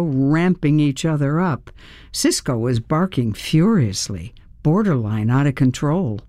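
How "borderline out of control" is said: ordinary delivery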